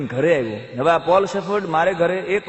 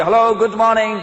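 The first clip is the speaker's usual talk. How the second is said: loud greeting; 5 semitones higher, 5 dB louder than usual. Acted. Foreigner greets again.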